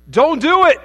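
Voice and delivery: high, loud cry to stop him; urgent plea. Acted. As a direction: shout to stop a man from jumping. Joke: rescuer calls to jumper.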